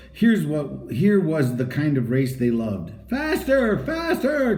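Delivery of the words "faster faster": high, urgent self-urging; urging himself. A narrator's reading. Henry urging himself on mid-race, high urgent cry. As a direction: push yourself on, high and urgent. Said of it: "cried Henry to himself".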